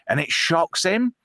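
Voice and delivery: normal talking voice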